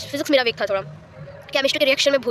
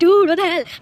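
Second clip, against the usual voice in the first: loud, high-pitched exclamation of disbelief; retold stunned reaction; shocked outburst; 6 semitones higher, 7 dB louder, much slower than usual. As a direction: blurt it out louder and higher, as if stunned. Acting out exam-time reaction.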